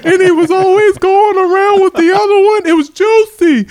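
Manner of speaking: big high-voiced chunky guy; rapper impression. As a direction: pitch it up high and stretch it out. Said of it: "see how I can switch it on".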